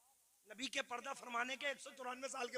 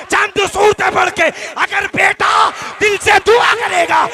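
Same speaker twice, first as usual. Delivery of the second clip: loud, high, rousing sermon shout; impassioned shouted exhortation; full-force preaching; 6 semitones higher, 34 dB louder than usual. Real, not acted. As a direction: preach it to the back of the crowd.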